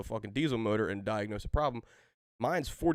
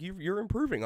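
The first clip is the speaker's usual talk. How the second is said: mock drill sergeant; 9 semitones higher than usual. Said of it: "My drill sergeant's like".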